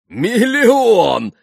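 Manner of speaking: booming laughing cry; laughing shout. A narrator's reading. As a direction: bellow it out with a laugh. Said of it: "захохотал Митя". (хохоча, во весь голос)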